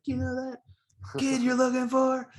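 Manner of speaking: high-pitched movie-style caller voice; mock-excited quote, pitched high; excited club guy. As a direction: imitate an urgent tip-off, way up high. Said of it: "the guy at the club".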